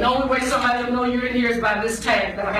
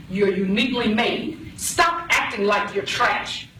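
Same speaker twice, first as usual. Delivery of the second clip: ordinary delivery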